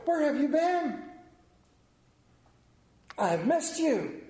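lightly voiced grandpa welcoming; grandfather's greeting. Grandfather greeting the grandson at the door, fondly. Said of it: "open up the door ... and go".

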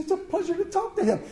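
fast, high, chuckling aside; gleeful chortle; delighted laughter. A narrator's reading. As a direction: laugh through it, tickled by the boy.

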